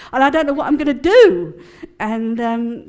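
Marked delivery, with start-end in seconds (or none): 0.1-1.5 fast, loud, half-laughing panic; mock-flustered outburst, louder and quicker; hissy fit. Telling the Toronto leader her fear, in a loud laughing fluster. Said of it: "very sort of hissy-fitty".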